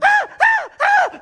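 three piercing, very high shrieks; hammed-up little-girl screaming; mock schoolgirl screams. Preacher mocking frightened fishermen, schoolgirl screams. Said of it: "screaming like a little schoolgirl".

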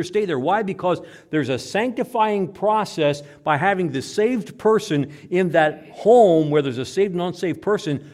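loud, high, emphatic sermon delivery; full-force preaching. Real, not acted. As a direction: drive each phrase home to the congregation.

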